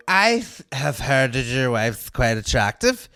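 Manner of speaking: heckler woman. Retold or imitated. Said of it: "and she would go".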